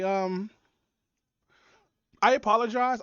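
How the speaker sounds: usual speaking voice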